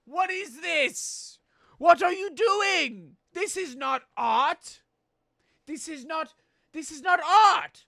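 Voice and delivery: high, loud, slow indignation; outraged chef. Acted. Chef at the customer, outraged and loud. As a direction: demand answers like an insulted artist.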